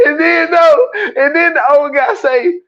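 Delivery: high, breaking into laughter; words squeezed out through laughs; laughing while talking. Real, not acted. Storyteller cracking up at his own story, laughing.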